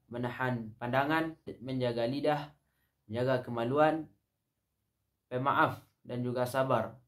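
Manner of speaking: normal talking voice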